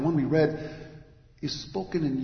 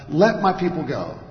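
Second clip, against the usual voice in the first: loud, commanding proclamation; proclaiming; 3 semitones higher, 10 dB louder than usual. Acted. Moses standing before Pharaoh, loudly proclaiming. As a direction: proclaim it forcefully as a demand. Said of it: "proclaiming to that one".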